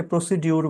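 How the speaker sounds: "প্রসিডিউর" with a "dyur" ending; common mispronunciation. Shown as a demonstration. Teacher quoting the common error, mispronounced with "dyur" in "প্রসিডিউর". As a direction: say "প্রসিডিউর" ending in d-yur, not a j sound. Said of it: "এই উচ্চারণটা অনেকে ভুল করে".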